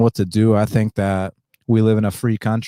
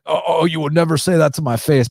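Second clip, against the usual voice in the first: higher-pitched sarcastic sing-song mockery; mocking whiny quote; 6 semitones higher than usual. Host mocking a hater's cliché, high sarcastic whine. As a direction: parrot the cliché in a snotty mock voice. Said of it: "Not to, not to be like".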